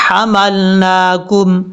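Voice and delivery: حملناکم with the ha clearly separated; slow clear recitation; broken ha. Shown as a demonstration. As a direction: stop briefly on the ha in حملناکم. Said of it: "ہا break ہے".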